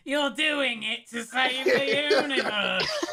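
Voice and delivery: exaggerated high, slow mock yell; hammed-up caricature, loud and drawn out; Leon's mental impersonation. Leon imagines a teammate yelling at him, exaggerated high impersonation. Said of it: "Leon's impersonate mental picture of me, so let me redo my line".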